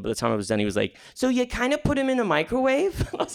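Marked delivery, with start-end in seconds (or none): 1.1-3.1 put-on high-pitched puzzled kid question; little kid checking, voice lifted high and rising at the end; five-year-old child asking. Five-year-old checking what his uncle explained, high questioning voice. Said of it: "to a five-year-old ... he was like".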